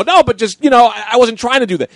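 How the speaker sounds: high, loud flustered insisting; apologetic protest. Retold or imitated. Insisting to annoyed players it was an accident, pleading. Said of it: "and then I felt bad".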